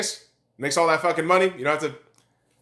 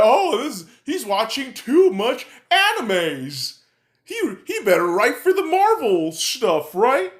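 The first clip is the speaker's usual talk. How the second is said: higher, drawn-out mocking imitation; mocking critic; 5 semitones higher than usual. Ranter imitates doubters who think he's joking, mocking. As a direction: ape the doubters with exaggerated swoops in pitch.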